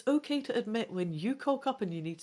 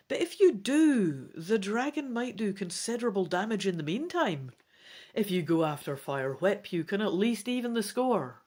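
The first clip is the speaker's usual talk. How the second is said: animated read-aloud storytelling; story narration; 6 dB louder than usual. A narrator's reading. Reads gamebook narration aloud.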